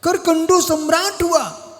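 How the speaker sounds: high-pitched proclamation; declamatory preaching. Real, not acted. Monk announcing that Karkandu became emperor, declamatory preaching. Proclaims the story's key event.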